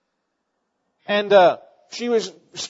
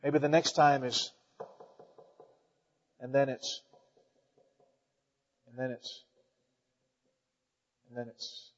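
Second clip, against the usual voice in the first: each phrase softer and lower until barely there; fading away; 6 semitones lower, 12 dB quieter than usual. Shown as a demonstration. Preacher showing the congregation a call growing faint, fading to nothing. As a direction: let the voice die away like a knock you stop hearing. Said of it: "what sounds loud at first".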